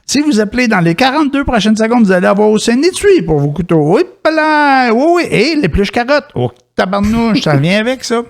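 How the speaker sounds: very high, hyped ad voice; infomercial. Pretend. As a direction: oversell it like a late-night ad. Parody ad, building hype. (annonceur de télé-achat très excité)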